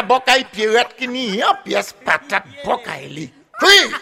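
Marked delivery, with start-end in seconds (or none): 3.6-3.9 sharp high call to the crowd; storyteller's call. Real, not acted. Storyteller calling out to the audience for a reply, sharp high call.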